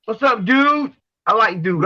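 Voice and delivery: ordinary talk, nothing marked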